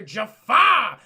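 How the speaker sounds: suddenly very loud, mock-grand; dramatic announcement. Guard cites the Grand Vizier's orders, booming announcement. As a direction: boom the name out.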